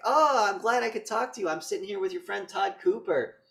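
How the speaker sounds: high, loud, oblivious friendliness; hammed-up chirpy delivery; mock cheerful chat. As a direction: imitate a pleased friend on the phone. Acts out oblivious lawyer on phone.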